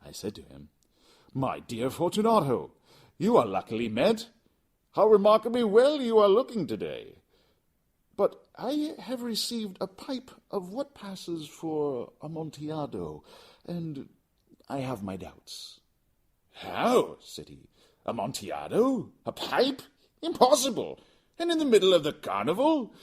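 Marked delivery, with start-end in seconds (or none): 1.3-15.8 high, loud, over-sweet flattering voice. Acted. Narrator voicing Montresor flattering his drunken friend, high and gushing. Montresor greets Fortunato in dialogue. 16.5-17.2 sharp loud exclamation. Acted. Fortunato startled by news of the Amontillado, loud. 18.0-22.9 very high, loud disbelieving voice; he can't believe his ears. Acted. Drunken Fortunato doubting the pipe, high and loud.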